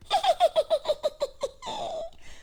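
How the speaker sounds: high mocking cackle of the robins; mocking bird laugh. Robins jeering at Toad, high mocking cackle. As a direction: cackle high at the failing kite.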